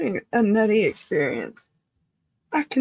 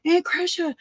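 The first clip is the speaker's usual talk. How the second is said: nephew calling; 7 semitones higher than usual. Retold or imitated. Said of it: "when I hear".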